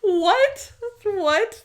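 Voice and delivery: amused disbelief. Real, not acted.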